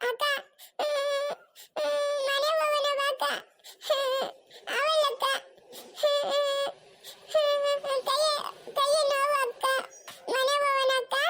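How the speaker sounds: put-on high, tearful child pleading with big sister; crying little child. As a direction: sob and whimper between short phrases. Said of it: "ಅವ್ನ್ ಅಳ್ತಾವ್ ನೋಡ್ರಿ".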